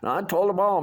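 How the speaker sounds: ordinary talk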